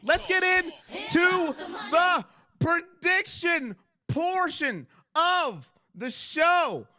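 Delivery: high, drawn-out announcer call; show announcer. Acted. Host launching the prediction segment for viewers, drawn-out announcer voice. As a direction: announce it big, stretching each word out.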